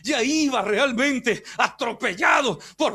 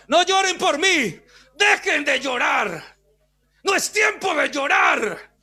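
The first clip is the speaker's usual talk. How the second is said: higher, ringing declaration; firm commanding cry; 5 semitones higher, 3 dB louder than usual. Retold or imitated. Preacher voicing Jesus commanding the women to stop crying. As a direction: order them to stop weeping. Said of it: "está dando palabra".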